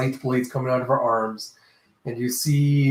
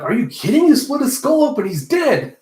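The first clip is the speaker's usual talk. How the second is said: high, loud protest of disbelief; hammed-up shrieked objection; mock incredulous outcry; 11 semitones higher, 5 dB louder than usual.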